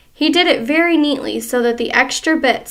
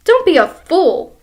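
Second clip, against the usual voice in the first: scornful husband; 5 semitones higher, 6 dB louder than usual. Acted. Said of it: "Mister Twit said".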